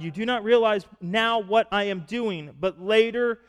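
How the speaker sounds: Jesus firmly telling Peter he will understand later; firm teacher. Retold or imitated. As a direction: set him straight with authority. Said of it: "Jesus replied".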